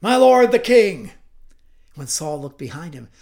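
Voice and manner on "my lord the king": high, booming shout; loud call. Acted. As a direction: call out across a distance at full voice. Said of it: "called out in a loud voice".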